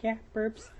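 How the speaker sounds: put-on high, soft little-kid answer; childish troll voice. Troll answering the girl's question, childish. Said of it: "I put on this little childish voice".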